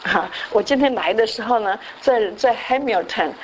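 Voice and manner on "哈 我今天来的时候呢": ordinary talk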